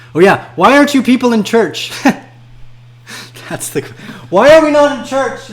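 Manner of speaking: high, loud mocking voice; mock indignation. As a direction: ham up the churchgoer. Reads church comment mockingly.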